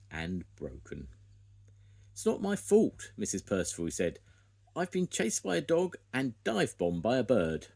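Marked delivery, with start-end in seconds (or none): 2.1-7.7 much higher, louder whiny protest; indignant protest. A narrator's reading. Spud protesting to Missus Percival about the broken eggs, loud. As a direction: protest your innocence.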